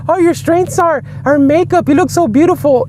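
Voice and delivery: high, sugary fake flattery; high mock-sweet compliment; mock gushing friend. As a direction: gush like a fake friend lying to your face.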